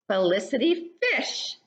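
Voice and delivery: usual speaking voice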